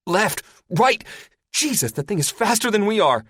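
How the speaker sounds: high, loud frantic directions; yell. Acted. Gunner yells directions from behind, frantic. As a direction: bark directions in panic. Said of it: "Athos yells".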